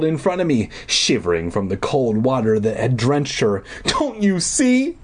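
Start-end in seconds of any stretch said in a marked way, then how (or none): 3.8-5.0 high, slow desperate cry; cried. Acted. Deirdre crying out to Wendy, high and slow. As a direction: make her see it, desperately. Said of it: "Deirdre cried".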